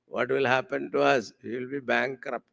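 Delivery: ordinary delivery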